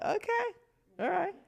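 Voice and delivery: high, hesitant okay, all right; a wary, wide-eyed all right; mock surprised okay. Reacting to young teammates.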